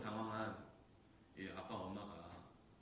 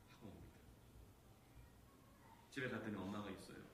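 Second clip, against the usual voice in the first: ordinary talk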